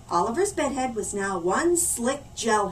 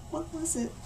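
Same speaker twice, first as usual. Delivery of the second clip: a sudden hushed question, much softer; soft spooky hush before the reveal; 4 semitones higher, 10 dB quieter than usual. A narrator's reading. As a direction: lean in and whisper the question.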